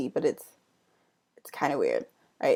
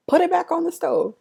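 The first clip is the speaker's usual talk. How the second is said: exasperated retelling; 13 semitones higher, 5 dB louder than usual. Retold or imitated. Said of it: "was like".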